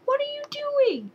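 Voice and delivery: ordinary talk, nothing marked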